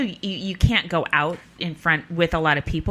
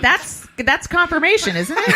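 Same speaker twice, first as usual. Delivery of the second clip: laughing; 13 semitones higher, 5 dB louder than usual. Real, not acted. Joking through laughter.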